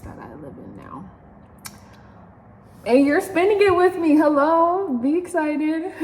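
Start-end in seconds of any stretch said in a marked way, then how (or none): 2.9-5.9 excited. Real, not acted. Excited greeting to viewers.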